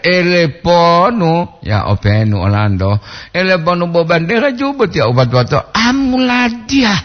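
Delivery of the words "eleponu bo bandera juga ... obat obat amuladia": drill-command intonation. Retold or imitated. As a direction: imitate a flag-ceremony commander's high call. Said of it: "kagak internasional ya". (intonasi aba-aba upacara, bernada tinggi)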